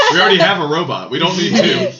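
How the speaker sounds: teasing through laughter; fast, laughing jab; laughing tease. Real, not acted.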